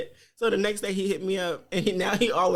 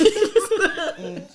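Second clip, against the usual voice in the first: a loud burst of real laughter; loud laughter breaking out; 10 semitones higher, 6 dB louder than usual. Real, not acted. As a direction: crack up out loud.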